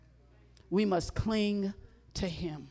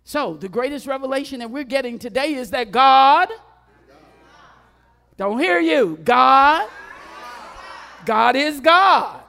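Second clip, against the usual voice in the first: building to a full shout, higher; preaching shout; 6 semitones higher, 12 dB louder than usual. Real, not acted. Preacher shouts to the congregation that God is God.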